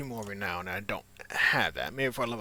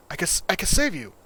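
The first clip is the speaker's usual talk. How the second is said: mildly raised, hurried plea; a restrained shout, quick and higher; 7 semitones higher, 6 dB louder than usual. A narrator's reading. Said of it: "you shout".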